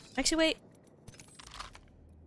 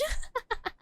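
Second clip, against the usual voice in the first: high giggle; 12 semitones higher, 6 dB quieter than usual. Real, not acted. Laugh after excited hype.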